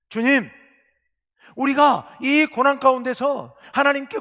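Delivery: fervent preaching voice; impassioned plea. Acted. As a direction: cry out to God on the people's behalf. Pleading prayer paraphrase.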